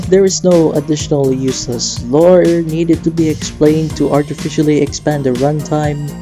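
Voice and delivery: normal talking voice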